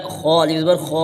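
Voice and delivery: खौ said with a heavy, full-mouthed throaty kh; teacher demonstrating a heavy खौ; heavy full-mouth kha. Shown as a demonstration. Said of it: "खौ को ऊपर पढ़ा जाएगा".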